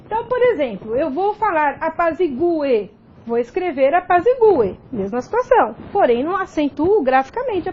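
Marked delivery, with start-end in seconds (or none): 1.8-2.9 apazigue slowly as a-pa-zi-GU-e; hiatus, stressed U. Shown as a demonstration. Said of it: "porém a pronúncia continua". 3.9-4.8 apazigue as a-pa-zi-GU-e; hiatus, stressed U. Shown as a demonstration. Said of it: "porém a pronúncia continua".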